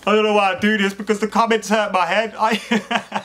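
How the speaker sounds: high voice breaking into laughter; helpless laughter. Real, not acted. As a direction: talk through a real laughing fit.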